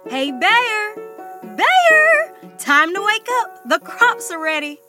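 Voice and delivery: calling out. Acted. Rabbit calls to wake Bear.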